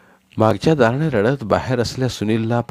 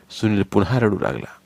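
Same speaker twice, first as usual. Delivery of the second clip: usual speaking voice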